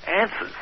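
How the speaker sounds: answers with a flat American a, an-sers; Clinton's answers. Shown as a demonstration. Host mimicking how Clinton says answers, flat a. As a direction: imitate Clinton saying answers, short a. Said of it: "Clinton would say".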